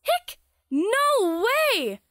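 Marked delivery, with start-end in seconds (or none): 0.0-0.4 short squeaky hiccup sound; a sharp little hiccup; high hiccup. Acted. Character has the hiccups.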